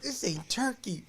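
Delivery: high, outraged squeal; high mock outrage. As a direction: squeal it in disgust. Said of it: "She goes".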